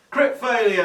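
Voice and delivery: high, loud announcement of disaster; loud exclamation. Real, not acted. Player announcing own botched dice roll, high loud cry. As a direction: call it out high and drawn to the table.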